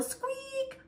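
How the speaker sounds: very high, soft squeaky noise; a thin high squeak. A narrator's reading. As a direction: imitate a squeaky bike wheel. Bike squeak sound effect.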